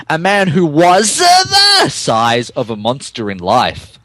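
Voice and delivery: usual speaking voice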